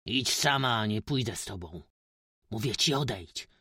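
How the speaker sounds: loud threatening rebuff; loud menacing dismissal; shouted menacingly. A narrator's reading. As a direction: push her away with a harsh shout. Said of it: "krzyknął groźnie".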